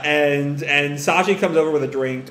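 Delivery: plain reading voice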